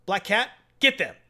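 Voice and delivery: high, pushy spoiled-boss commands; a spoiled kid barking orders; bratty villain orders. Retold or imitated. Bratty villain ordering his henchmen.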